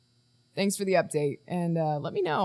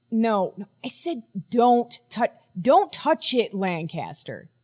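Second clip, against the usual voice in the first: sharp warning; 3 semitones higher, 5 dB louder, slower than usual. Acted. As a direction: cut in urgently, pushing the pitch up. Warning a colleague off the device.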